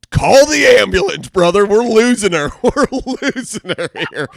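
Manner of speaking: high, loud panicked yell; yelling for help. Retold or imitated. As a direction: shout the orders as if she is slipping away. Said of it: "I yell at Oliver".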